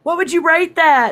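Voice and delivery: put-on high, loud kid yelling for grandma; imitated granddaughter's loud, high shout; child hollering. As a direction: holler it out like a proud little girl. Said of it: "she hollers".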